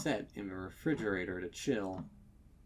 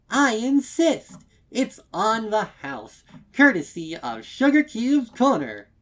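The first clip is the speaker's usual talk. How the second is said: much higher and far louder, a bubbly insistent shopkeeper; 13 semitones higher, 16 dB louder than usual. Acted. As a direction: push the free cake on them with bright, loud cheer. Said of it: "eager shopkeeper insists on free cake".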